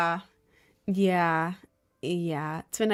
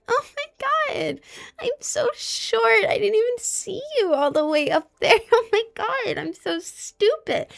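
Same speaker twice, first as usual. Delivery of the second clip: fake cutesy girl voice; 13 semitones higher than usual. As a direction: act helpless and adorable. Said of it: "That's the girl equivalent".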